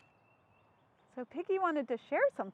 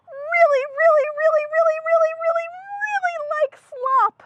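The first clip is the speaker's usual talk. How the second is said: higher, gushing happy pig; Piggy voice; 13 semitones higher, 12 dB louder than usual. Acted. Piggy telling Gerald how pigs love slop, high gushing voice. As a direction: gush about slop like a proud pig. Piggy voice held over lines.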